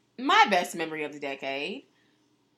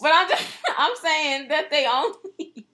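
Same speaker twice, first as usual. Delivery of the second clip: laughing; 7 semitones higher, 4 dB louder than usual. Real, not acted. Laughs while arguing her point.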